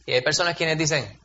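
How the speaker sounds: ordinary delivery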